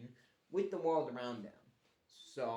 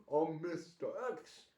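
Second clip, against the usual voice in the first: slow, hammed-up character voice growing higher and louder; mock corny villain; 6 semitones higher, 8 dB louder than usual. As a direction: parody a goofy villain introducing himself, slowly. Mocking the character's silly introduction.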